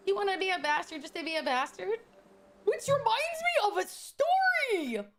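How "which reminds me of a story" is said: high, slow, drawn-out announcement; excited story launch. Real, not acted. Streamer announcing a story to viewers, excited. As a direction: stretch it out high, with relish.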